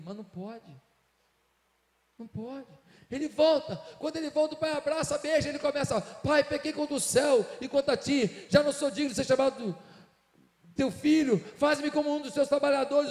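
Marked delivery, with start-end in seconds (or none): 6.0-13.1 higher, louder, begging father; son's pleading confession. Acted. Son confessing to his father, loud and pleading. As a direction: cry it out as the broken son.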